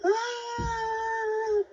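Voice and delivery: high crying mock scream; imitated scream. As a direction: let out a short terrified cry. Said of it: "like, loud scream".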